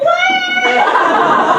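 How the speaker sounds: high shrieking "Lions!"; panicked scream. Retold or imitated. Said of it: "freaking out like".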